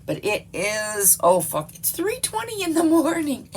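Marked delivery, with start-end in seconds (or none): 1.8-3.5 high-pitched disbelief at the time; real surprise. Real, not acted. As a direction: exclaim it high, startled by the clock. Notices how late it is.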